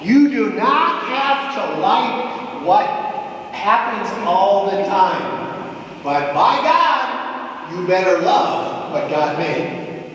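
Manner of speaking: slow, each word weighted, "not" pressed; slow emphatic. Shown as a demonstration. Preacher to the congregation, slow and emphatic. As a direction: drive it home slowly. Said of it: "preaching point".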